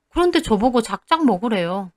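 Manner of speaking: ordinary delivery, nothing marked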